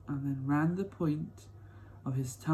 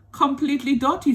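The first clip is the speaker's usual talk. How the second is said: put-on scornful old grandpa; 11 semitones higher, 9 dB louder than usual. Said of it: "said Grandpa George".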